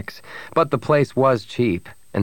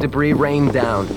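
ordinary delivery, nothing marked

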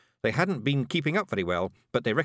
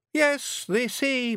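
very high, light Scots voice; a timid start at reading; little Feegle pipes up; small Feegle; much slower than usual. A narrator's reading.

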